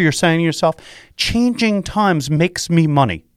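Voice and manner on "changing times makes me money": slow, deliberate chant-like phrase; measured slogan delivery; slow mantra. Shown as a demonstration. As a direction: lay it out like a motto to repeat. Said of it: "Let's repeat that together".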